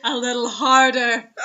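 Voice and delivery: slow, loud, cracking up; barely holding it together; laughing while reading. Real, not acted. Host reading the next line, laughing hard.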